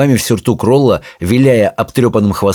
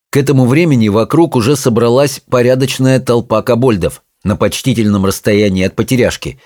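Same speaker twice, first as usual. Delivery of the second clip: ordinary delivery, nothing marked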